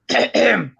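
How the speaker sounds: repeated theatrical ahem; mock-warning ahem-ahem; pointed throat clearing. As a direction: clear your throat pointedly, several times. Pointed interruption after shady critique.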